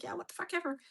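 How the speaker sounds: high, soft mock brush-off; retold dismissive comeback. Answering whoever yelled at her, high dismissive retort. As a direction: re-enact brushing someone off.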